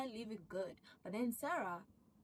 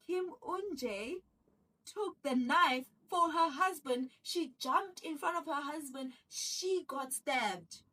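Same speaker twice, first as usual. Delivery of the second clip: louder and higher, pressing the story; dramatic telling; 7 semitones higher, 10 dB louder, slower than usual. Retold or imitated. Escort insisting to the police, loud and dramatic. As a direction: sell the lie with big emphasis.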